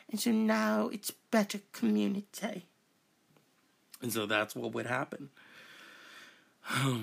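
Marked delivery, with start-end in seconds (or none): 0.1-2.7 high-pitched mock British accent; put-on British accent. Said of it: "had to talk like this".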